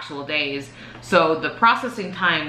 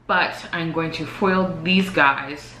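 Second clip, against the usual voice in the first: normal talking voice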